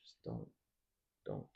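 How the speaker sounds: soft urgent advice; hushed warning. Real, not acted. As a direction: murmur it to him low and close. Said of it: "Don't listen to a woman's cries".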